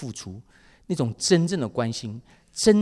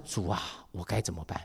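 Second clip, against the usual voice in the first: 1 hushed prayer; 8 semitones lower, 10 dB quieter than usual. Retold or imitated. Silent prayer in crisis.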